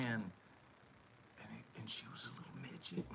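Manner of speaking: hushed, fast whisper of disbelief. Real, not acted. Storyteller whispering to the crowd about the wife, hushed. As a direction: whisper it like a secret.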